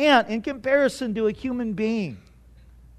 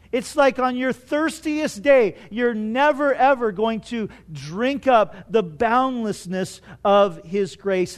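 ordinary talk

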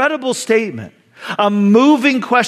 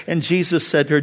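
ordinary talk, nothing marked

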